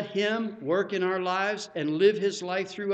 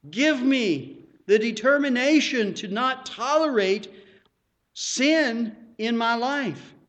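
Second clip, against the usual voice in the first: high, slow pleading prayer; preacher praying aloud with fervour; fervent prayer; 7 semitones higher, 3 dB louder, slower than usual. Acted. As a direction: lift it up to God earnestly.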